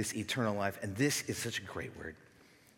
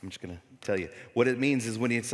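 normal talking voice

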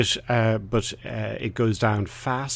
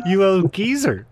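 teasing; 9 semitones higher, 5 dB louder than usual. Pretend. Teasing co-host about his age.

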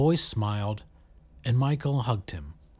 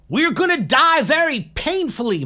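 high, loud desperate yell; 7 dB louder than usual. A narrator's reading. Michael yelling back to Saradwan in the crash, high and loud. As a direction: yell back over the chaos. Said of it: "Michael yelled back".

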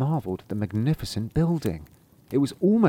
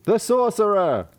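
woodcutters answering; 10 semitones higher, 3 dB louder than usual. Acted.

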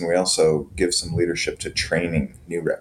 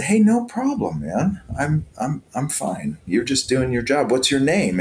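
reassuring; 7 semitones higher than usual. Retold or imitated. Reassuring the guard, retold.

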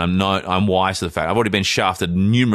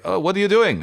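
put-on Indian accent; 11 semitones higher than usual. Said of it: "Are you really doing the accent?".

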